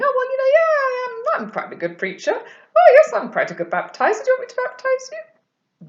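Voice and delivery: loud, high, hammed-up boasting; booming, swooping comic bragging; mock self-important. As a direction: send up a preacher showing off. Mock boastful John, what he wasn't.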